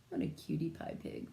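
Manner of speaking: whispered aside. Real, not acted. Teacher coos over the piglet.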